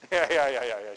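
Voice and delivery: laughing rueful yeahs; bitter laugh. Real, not acted. Carolina admitting it happens to them, bitter laughing yeahs. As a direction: laugh it off with a sour edge. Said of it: "She says bitterly".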